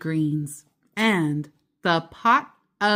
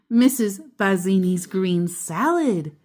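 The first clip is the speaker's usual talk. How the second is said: plain reading voice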